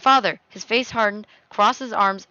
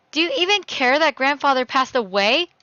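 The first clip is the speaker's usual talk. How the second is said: raised, higher accusing voice; angry; 6 semitones higher, 6 dB louder than usual. Acted. Daughter confronts father about grandfather's death, angry. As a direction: snap into a loud, angry challenge. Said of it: "it made me feel so angry".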